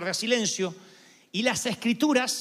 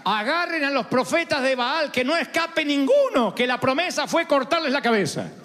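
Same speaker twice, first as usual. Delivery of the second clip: high, forceful prophet's order to a crowd; urgent yelled command as Elijah; shouted command; 5 semitones higher, 4 dB louder than usual. Acted. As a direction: shout the order across the mountain. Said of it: "Y Elías grita".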